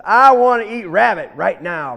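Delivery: loud, insistent bully alligator; threatening demand. Acted. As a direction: demand it forcefully, meant to scare. Alligator threatens to eat the rabbit.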